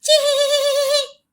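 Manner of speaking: high, drawn-out whinnying neigh; whinny again like a hurt old horse. A narrator's reading. Lame horse trips a second time, high drawn-out neigh. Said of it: "আবার তার".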